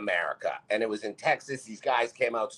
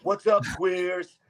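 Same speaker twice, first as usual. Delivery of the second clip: higher, slow and airy mock skater greeting; mock figure skater; 8 semitones higher, 4 dB louder, slower than usual. Bodybuilder mocking a figure skater, higher and slow. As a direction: glide into it like a sneering figure skater. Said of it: "figure skaters are gliding by going".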